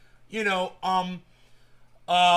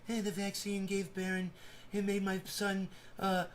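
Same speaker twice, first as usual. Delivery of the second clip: hushed presidential impression, softer than usual; Donald Trump; 9 dB quieter than usual. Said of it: "say vaccine in his voice".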